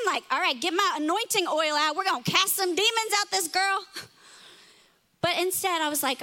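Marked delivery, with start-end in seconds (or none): 0.3-3.9 loud, fired-up mock exorcist; mock exorcist zeal. Parent ready to cast out demons, loud and fired up. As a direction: ham up the eager demon-caster. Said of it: "that's what I was thinking".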